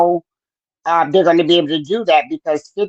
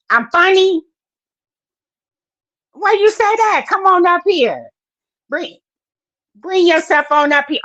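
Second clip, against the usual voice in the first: much higher, teasing reply; playful high voice; 11 semitones higher than usual. Real, not acted. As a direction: toss it back with playful delight. Reacting to chat comment.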